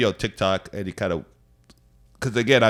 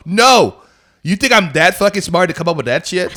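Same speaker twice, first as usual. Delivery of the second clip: loud, high-pitched protest; indignant retort; 8 semitones higher, 8 dB louder than usual. Retold or imitated. Answering commenters who doubt his story, indignant and loud. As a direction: push back in loud disbelief.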